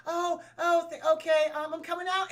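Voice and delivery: high, nervous hiding voice; a hammed-up scared girl; mock timid voice. Halle hiding from henchmen, timid reply. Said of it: "she's like".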